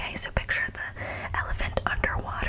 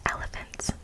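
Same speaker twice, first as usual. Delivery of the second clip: ordinary delivery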